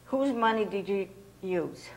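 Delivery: ordinary talk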